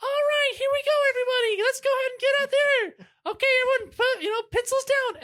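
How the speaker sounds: hammed-up shrill motivational speaker rallying a class; shrill trainer voice. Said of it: "this really shrill voice".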